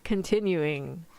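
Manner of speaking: plain reading voice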